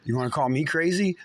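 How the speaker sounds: ordinary talk, nothing marked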